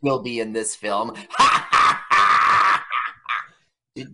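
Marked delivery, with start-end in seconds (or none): 1.2-3.4 harsh, loud bursts of laughter; hammed-up staccato guffaw; loud mock cackle. Laughing at his own Farrah Fawcett joke, loud cackle.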